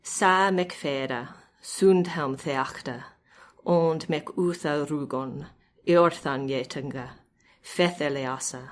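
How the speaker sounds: put-on slow, measured reading of an old riddle, each half line set apart; Old English recitation. Shown as a demonstration. Scholar reads an Exeter Book riddle aloud in slow recitation. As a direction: recite the alliterative verse slowly with pauses between half lines. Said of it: "So this is, uh, number seventy-seven".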